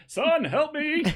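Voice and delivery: high, hammed-up plea; high-pitched cry for help. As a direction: call for help in a very high squeal.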